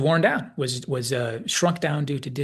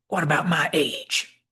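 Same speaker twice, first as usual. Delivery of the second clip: put-on slow, higher Southern accent; touchy old Southerner, drawn out; offended old-timer with a Southern accent; Southern McCoy; 4 semitones higher, 3 dB louder, slower than usual. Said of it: "picked up some kind of Southern accent".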